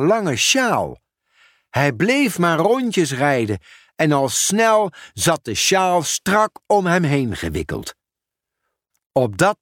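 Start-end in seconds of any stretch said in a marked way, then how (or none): none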